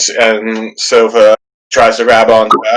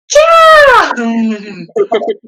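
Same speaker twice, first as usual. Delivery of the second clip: mock shriek; 13 semitones higher, 3 dB louder than usual. Imagining Lucy's embarrassed reaction.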